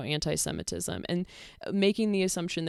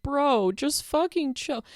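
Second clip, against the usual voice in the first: exasperated; 8 semitones higher, slower than usual. Real, not acted. Venting at the other creator.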